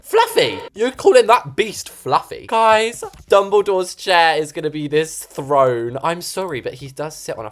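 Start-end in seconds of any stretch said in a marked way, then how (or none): 0.0-2.5 mock disbelief. Mock outrage at the name.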